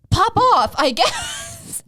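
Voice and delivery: high, sarcastic retort; mock-apologetic sass; sarcastic reply to a pushy waiter; sassy pop off.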